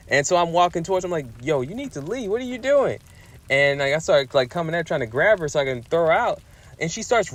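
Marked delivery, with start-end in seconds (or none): 1.4-3.0 exasperated retold demand; retold exasperation. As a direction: act out telling someone to leave, pitch climbing steeply. Acted order to leave his house.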